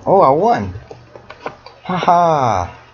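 high-pitched delighted outburst; gleeful high victory cry; surprised triumph. Real, not acted. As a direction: burst out crowing at a sudden win. Sudden win.

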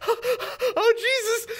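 very high, terrified wail; hammed-up cry of someone about to crash; mock panic. Boy on broken sled heading for minivan, mock panic.

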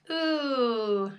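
a drawn-out ooh of disgust. A narrator's reading. George hears of caterpillars, disgusted ooh. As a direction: recoil with a long ooh.

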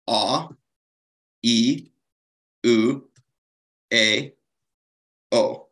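a, e, u, a, o each said slowly and clearly apart; vowel demo. Shown as a demonstration. Teacher sounding out the five Narama vowels for learners. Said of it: "Narama has five basic vowels".

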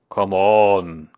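loud call at normal pitch; owl calling out. A narrator's reading. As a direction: call it ponderously, drawing out the last word. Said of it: "called Owl".

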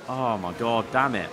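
usual speaking voice

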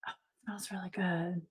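hushed whisper of pleasure; delighted whisper. Real, not acted. Smelling the galaxy wax, delighted whisper.

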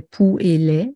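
"pou" and "lait" as two separate syllables; split into syllables. Shown as a demonstration. Teacher showing students how poulet divides, split into syllables. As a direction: say "pou", then "lait", each on its own. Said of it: "je divise les poulets en deux".

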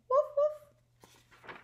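high little puppy woof; dog sounds. Acted. Teacher reading to her class, a high puppy bark. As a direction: bark it like a small playful dog. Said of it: "all of my dog sounds".

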